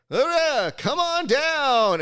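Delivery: high, loud, drawn-out announcer call; game show announcer. Retold or imitated. Announcer calling a contestant to come down, hollered. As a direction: holler it with the last words stretched. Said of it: "and they say".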